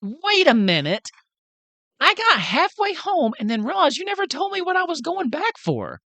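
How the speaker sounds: put-on high-pitched, indignant little-girl voice; put-on high voice, outraged protest; Nellie. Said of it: "Nellie runs in. She goes".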